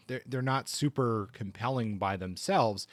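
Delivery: normal talking voice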